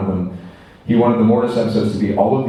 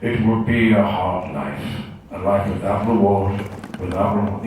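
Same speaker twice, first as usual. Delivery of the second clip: Liam Neeson. Retold or imitated. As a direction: recite it solemnly, with pauses between phrases. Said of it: "you hear Liam Neeson go".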